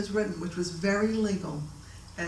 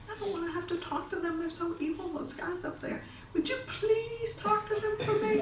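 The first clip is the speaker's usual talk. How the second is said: small, high, soft pleading voice; Norma pleading; 12 semitones higher, 5 dB quieter than usual. Retold or imitated. Timid client asking friend to talk for her, pleading. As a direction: beg for rescue in a hushed, high mock whine.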